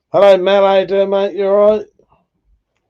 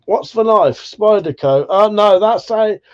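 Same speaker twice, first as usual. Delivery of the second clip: normal talking voice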